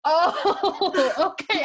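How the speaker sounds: high, loud, laughing through it. Retold or imitated. Imitating her friend's puzzled reply, laughing and high. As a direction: giggle out the words while imitating a friend.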